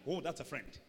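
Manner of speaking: ordinary delivery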